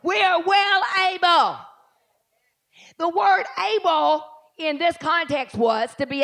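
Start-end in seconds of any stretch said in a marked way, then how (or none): 0.0-1.6 loud, proclaiming call; shouted preaching declaration; declaring. Real, not acted. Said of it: "Look at somebody and say".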